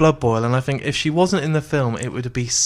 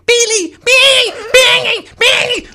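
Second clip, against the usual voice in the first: shrill, much higher, loud repeated yell; screaming wife; 9 dB louder, slower than usual. Retold or imitated. Podcaster mimicking Gloria screaming at Billy, high and loud. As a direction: scream his name over and over. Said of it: "Like this, constantly".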